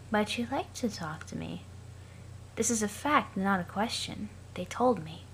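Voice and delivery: lifeless, monotone Aradia; flat as if long dead. Acted. Aradia asks Sollux about teams, in a dead monotone. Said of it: "that's how I'll be reading Aradia".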